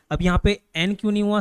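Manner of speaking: ordinary delivery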